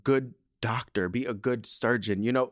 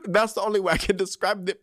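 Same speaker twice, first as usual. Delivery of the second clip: laughing; 8 semitones higher, 5 dB louder than usual. Real, not acted. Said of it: "how I go from crying to laughing".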